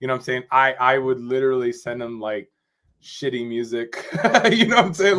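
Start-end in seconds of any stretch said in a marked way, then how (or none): 4.1-5.2 laughing through the question, higher and louder. Real, not acted. To a friend, recalling the shitty music he sent, laughing. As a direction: laugh it out as you ask. Laughing while telling a story.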